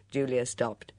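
usual speaking voice

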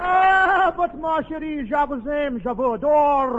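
put-on high swooning French accent, lingering on the vowels; French lover. Hope as Charles Boyer, wooing Paulette in a French accent. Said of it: "that Frenchman".

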